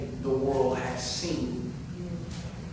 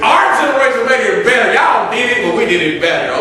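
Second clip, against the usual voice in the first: bursts out louder with a laugh; laughing shout; 7 semitones higher, 12 dB louder, faster than usual. Real, not acted.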